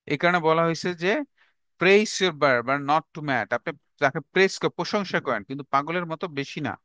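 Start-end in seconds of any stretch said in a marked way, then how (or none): 1.8-3.6 each word spaced out for notes; slow, deliberate dictation for students to copy. Shown as a demonstration. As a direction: dictate it slowly to the class. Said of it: "repeated dictation".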